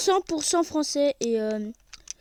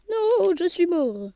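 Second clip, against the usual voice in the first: slow, loud groan of defeat; dismayed; 5 semitones higher, 6 dB louder, slower than usual. Real, not acted. Gamer realizing he just died, loud slow dismay. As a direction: drag it out in dismay. Character dies in game.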